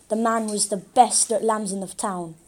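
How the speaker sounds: usual speaking voice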